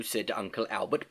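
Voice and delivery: plain reading voice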